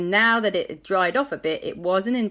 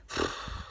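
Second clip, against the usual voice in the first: long tired exhale; weary sigh; 9 dB quieter than usual. Acted. Jack after the crash, sighing wearily. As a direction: let out a deflated sigh.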